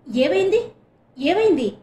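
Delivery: loud, high worried questions; anxious asking. A narrator's reading. Classmates crowd round the fainted girl, loud anxious questions. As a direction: press them anxiously for an answer. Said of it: "కంగారుగా".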